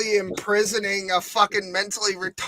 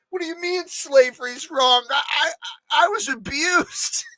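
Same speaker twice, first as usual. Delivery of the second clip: whiny mock sobbing, higher pitch; crying; 6 semitones higher than usual. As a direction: blubber like a caught crybaby. Mocking a crying, defensive slaver.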